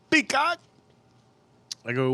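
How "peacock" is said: much higher squeaky one-word answer; imitating neighbor. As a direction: mimic the neighbor's pitched-up reply. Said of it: "He goes".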